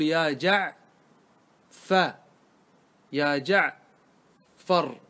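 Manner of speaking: "جعفر" broken by a long pause before the last syllable; slow "جعفر", ending withheld, then delivered; suspenseful split word. Shown as a demonstration. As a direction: hold the listener waiting mid-word in "جعفر". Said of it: "السامع ينتظر منك أن تنطق بالراء".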